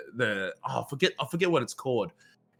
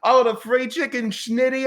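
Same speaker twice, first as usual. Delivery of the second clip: higher, louder gleeful bro voice; excited Aussie; 10 semitones higher, 8 dB louder, slower than usual. Retold or imitated. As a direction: cheer over free food like a stoked bloke. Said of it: "I was like".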